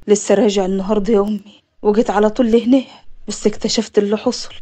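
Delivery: low-pitched man's voice, subdued; low, sober introduction; composed returning nephew. Acted. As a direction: play the man deep and steady.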